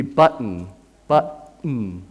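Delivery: "button" with the T as a catch in the throat; glottal stop. Shown as a demonstration. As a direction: say "button" as bu'-n, no T released. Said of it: "will become a glottal stop".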